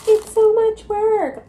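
high, slow, loud mocking whine. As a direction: mimic a nagging critic. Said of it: "some people are gonna be like".

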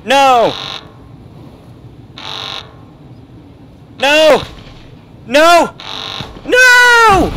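loud, very high repeated screams; shrieking protest at a sudden shock; screaming no. Real, not acted. Screams at attack in the game.

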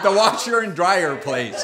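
chuckling through a self-mocking quote; mock his own clumsy word with a laugh; laughing at himself. Preacher repeating his own word for it, laughing. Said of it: "I'm like".

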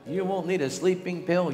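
ordinary talk

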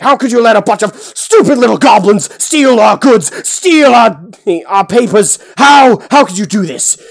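high, loud enraged rant; furious yelling. Acted. Boric ranting at his guards, furious shout. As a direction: scream at them in a blind rage. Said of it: "in the middle of yelling".

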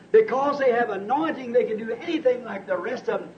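ordinary delivery

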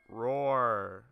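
loud put-on monster roar; hammed-up Godzilla roar; mock roar. Mocking Godzilla enemy in game.

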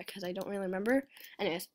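ordinary delivery

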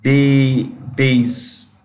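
"Day", then "days", broken down for learners; long ay in both "Day" and "days"; pronunciation demo. Shown as a demonstration. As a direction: build "days" up from a held "Day". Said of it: "How do you pronounce it?".

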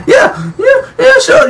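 high, laughing yeah yeah sure; laughing mock agreement. Real, not acted. Player laughing at his character, high mocking agreement. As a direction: laugh it off in disbelief.

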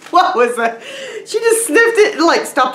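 laughing through the words, pitch shooting up; high, loud, tickled by the dog; real amused laughter. Real, not acted. Amused by dog sniffing the gift.

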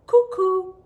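high, loud sung-out cuckoo, falling; cuckoo call. Retold or imitated. Narrator imitating the cuckoo overhead, high bird call. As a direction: imitate the bird's two-note call. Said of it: "it called".

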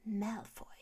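whispered, venomous name; angry hiss. A narrator's reading. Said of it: "sah ihn wütend an und zischte".

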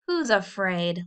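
ordinary delivery

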